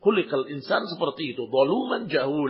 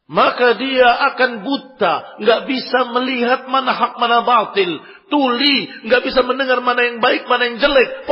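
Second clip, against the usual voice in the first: raised, forceful preaching voice; much louder and higher, hammering each phrase; preaching loudly; 7 semitones higher, 8 dB louder than usual. Acted. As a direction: drive the warning home with full force. Preacher's emphatic rebuke.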